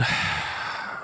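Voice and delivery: long weary exhale before bad news; heavy sigh. Real, not acted. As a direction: sigh like you can't bear it. Dreading talk about the loss.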